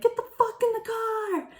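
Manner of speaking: very high, loud mock scream of an enraged mother; hammed-up retold yell from the car; furious mom yell. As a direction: shriek it like a livid parent. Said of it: "and she's like".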